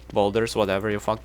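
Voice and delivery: ordinary talk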